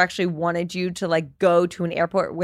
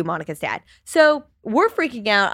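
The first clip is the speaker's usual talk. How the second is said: usual speaking voice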